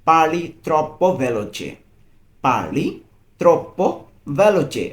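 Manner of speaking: very slow, every syllable laid out; slow model phrase. Shown as a demonstration. Teacher models the Italian phrase for a learner, very slowly. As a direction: read it out slowly so a learner can copy. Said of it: "এটার Italian".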